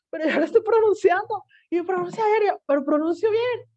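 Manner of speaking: put-on high, insistent kid's protest; a child insisting she says it right. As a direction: plead your case like a frustrated kid.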